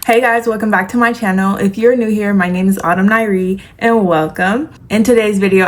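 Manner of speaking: ordinary talk, nothing marked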